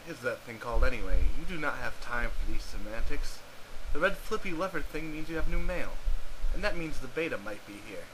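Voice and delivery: deadpan game narrator; story narration. A narrator's reading. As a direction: narrate the game with dry mock grandeur. Narrates the adventure.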